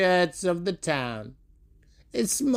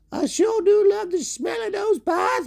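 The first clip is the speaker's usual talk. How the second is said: character voice. Acted. Character voice in story.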